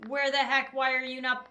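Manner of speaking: ordinary talk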